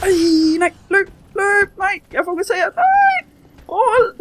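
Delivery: high, loud cries while fleeing; panicked shouting. Real, not acted. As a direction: scream at yourself to run. Fleeing an attacker in game. (panisk råben, højt og skingert)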